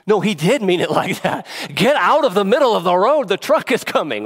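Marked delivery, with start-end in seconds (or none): 1.7-4.2 loud, higher warning yell; urgent warning shout to a child in the road. Pretend. Shouting to child near truck.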